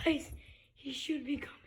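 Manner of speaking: hushed unvoiced whisper. Real, not acted. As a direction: whisper like hiding. Hiding, whispering to camera.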